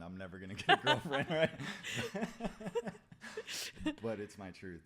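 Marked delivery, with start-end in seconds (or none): 0.0-1.5 laughing out the words, much louder; laughing through it. Retold or imitated. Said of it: "I was like". 1.6-4.0 a burst of loud, high laughter. Real, not acted. Laughs at own confession.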